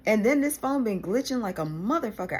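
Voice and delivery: normal talking voice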